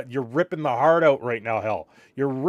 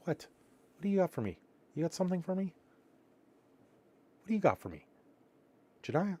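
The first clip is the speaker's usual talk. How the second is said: soft, higher pretend dog voice, slowing on the last ask; voicing the dog; 5 semitones higher, 7 dB quieter than usual. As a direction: playfully speak for the sleepy dog begging for a treat. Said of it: "She's like".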